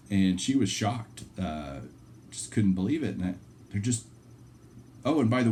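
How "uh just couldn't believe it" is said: ordinary talk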